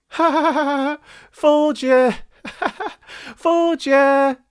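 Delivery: put-on high, gleeful boy's taunt; mocking laugh. A narrator's reading. Boy mocks the tricked villagers, high cackling taunt. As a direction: laugh in their faces. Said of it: "laughed the shepherd's son".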